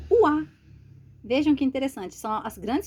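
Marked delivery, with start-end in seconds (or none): 0.1-0.4 o A called out louder and higher to show that oi is read wa; loud classroom demo, oi sounds like wa; oi read as wa, loudly. Shown as a demonstration. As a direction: hit o A hard as the teacher's key sound. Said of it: "fonemas oi, a gente vai ler".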